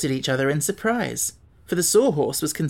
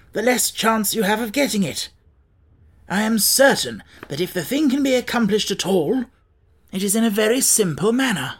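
raised pitch, animated storybook character; lightly voiced Scarecrow, opinionated; 7 semitones higher, 4 dB louder than usual. Scarecrow objects to the others' mathematics, lightly voiced, animated. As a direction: argue it in a higher character voice.

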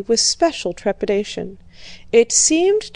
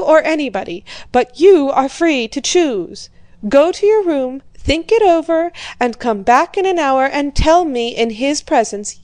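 loud, higher domineering commands; a father shouting orders to his daughter; 4 semitones higher, 5 dB louder than usual. A narrator's reading. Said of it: "He still shouted".

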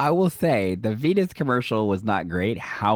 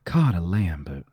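slow Southern drawl; Southern accent; 5 semitones lower than usual. Shown as a demonstration. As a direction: let it roll off slowly, Southern-style. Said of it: "Say it with a Southern accent".

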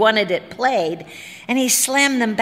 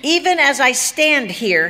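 loud, high-pitched defiant proclamation; bellowing; 6 semitones higher, 5 dB louder than usual. A narrator's reading. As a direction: bellow it like a tyrant addressing his subjects. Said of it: "he bellowed".

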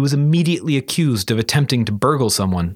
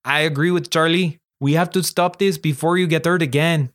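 put-on slightly higher, accented character voice; Marie, arguing; 4 semitones higher than usual. Girl arguing her friend needs help, accented insistent voice. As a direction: press the case as the concerned friend.

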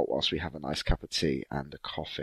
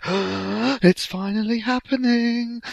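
high, slow, squealed delight; thrilled gasp; 5 dB louder, much slower than usual. Retold or imitated. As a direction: squeal it out like a dream come true. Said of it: "And she was like".

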